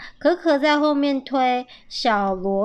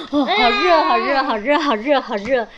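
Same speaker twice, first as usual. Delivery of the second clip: overheated; 3 semitones higher, 5 dB louder than usual. Acted. Parent showing a child how running makes you sweat, overheated. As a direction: pant out the heat, repeating urgently. Acting out getting hot.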